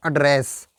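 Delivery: "एड्रेस" with a short unstressed uh first; verb pronunciation. Shown as a demonstration. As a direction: say "एड्रेस" as uh-DRESS. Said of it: "एड्रेस बोलना है". ("एड्रेस" छोटे "अ" से शुरू)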